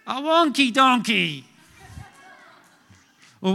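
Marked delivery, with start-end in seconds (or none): 0.1-1.4 high, loud, slow goofy punchline; hammed-up punchline. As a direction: sell the dad joke big. Dad joke punchline.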